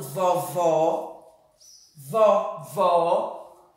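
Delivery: "vovó" low and deliberate with open o; "vovó" with a wide open ó sound; open vowel. Shown as a demonstration. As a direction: say "vovó" slowly, opening the final "vó". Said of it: "O está com o som aberto".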